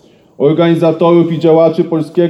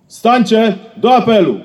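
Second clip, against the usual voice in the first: ceremonial call; 5 semitones higher than usual. Real, not acted. Ceremonial roll call refrain.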